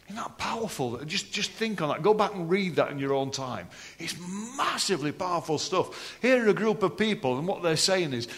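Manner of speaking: ordinary talk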